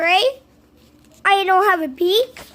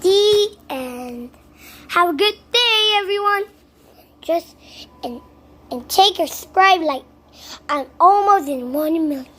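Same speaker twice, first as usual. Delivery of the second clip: plain reading voice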